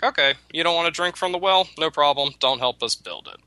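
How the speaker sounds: the animals brushing off the lazy rabbit, mildly mocking; mock dismissive animals. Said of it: "the animals are like".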